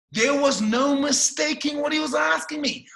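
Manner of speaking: usual speaking voice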